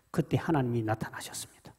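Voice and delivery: whispered, voiceless announcement of 하나님이; hushed. Shown as a demonstration. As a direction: breathe it out as a hushed reveal. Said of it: "hushed narration moment". (속삭이듯 하나님의 등장)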